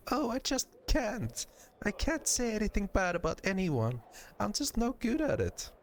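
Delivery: high-pitched, apologetic innocent; high character voice, pleading helplessness; reluctant, sweet Nenny. Acted. Nenny reluctant to speak ill.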